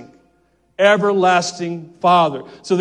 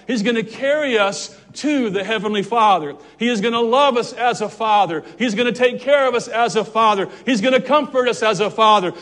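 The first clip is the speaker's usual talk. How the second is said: climbing to a full preaching cry, higher and louder; building preaching; 3 semitones higher, 4 dB louder than usual. Real, not acted. Preacher swelling to a climax for the congregation, building preaching. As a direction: build each line louder like a rising sermon. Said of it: "I'm gonna preach a little bit".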